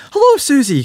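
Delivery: high squeaky little pig; bright childlike piggy voice; Peppa Pig voice. A narrator's reading. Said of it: "cried Peppa".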